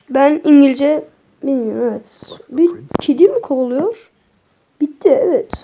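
normal talking voice

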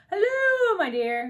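high, loud hail, easing off on the endearment; calling out. A narrator's reading. The king hails his arriving queen, calling out. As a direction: sing out a greeting across the room. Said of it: "he called".

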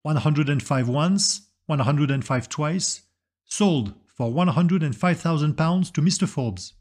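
auctioneer calling. Acted. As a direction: draw out the call like an auctioneer closing a sale. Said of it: "the auctioneer announce".

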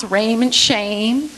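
"raiment, shame" with the A drawn out; long A sounds. Shown as a demonstration. Teacher picking out sounds in the verse, long A sounds. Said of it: "the long A sounds".

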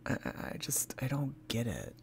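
hushed puzzled whisper. Real, not acted. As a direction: breathe it under your voice. Puzzled aside, dropped voice.

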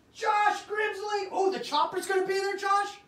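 high, loud hyped shout-out to chat; yelling names at the stream; excited shout. Real, not acted. Host hyping a chat member, high loud shout.

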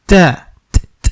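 তা and ত with a puff of air; breathy ta. Shown as a demonstration. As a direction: let air escape on ত. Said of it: "এরকমের করে বোঝাতে হবে". (বাতাস দিয়ে ত উচ্চারণ)

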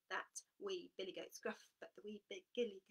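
ordinary delivery, nothing marked

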